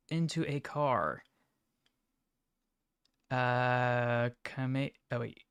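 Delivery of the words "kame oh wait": stumbling and cutting off words; stuttering. Real, not acted. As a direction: trip over the words, stuttering. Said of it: "I'm stuttering".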